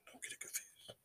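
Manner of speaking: hushed warning aside; whispered aside to viewers. Real, not acted. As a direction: drop to a whisper, conspiratorial.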